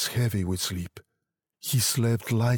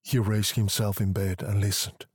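ordinary delivery, nothing marked

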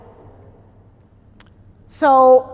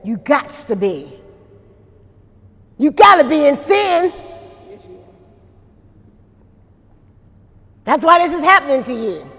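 mock harsh insistence; 2 semitones higher than usual. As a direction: insist he's in sin, half-acted. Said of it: "he spoke to him harshly".

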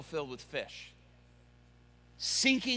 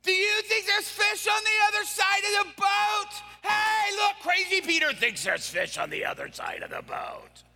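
high, shouted jeering from shore; laughing, taunting shout across the water; mocking fishermen; 12 semitones higher than usual. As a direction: call out to ridicule him. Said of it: "They're like".

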